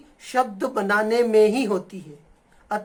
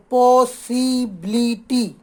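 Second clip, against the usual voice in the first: Possibility slowly in separate beats, पॉ, सी, बिली, टी; syllable by syllable; much slower than usual. Shown as a demonstration. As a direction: break possibility into its syllables with short stops. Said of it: "उतना हमें रुकना होता है". (Possibility को धीरे-धीरे हिस्सों में तोड़कर बोलें)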